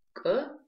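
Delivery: «к» коротко и выше, без придыхания; выдели начальное «к» перед словом; отдельный звук к. Shown as a demonstration. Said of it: "запоминаем с помощью слова". (отрывистое «к» без выдоха)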